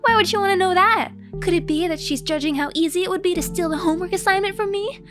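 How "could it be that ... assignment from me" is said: ordinary delivery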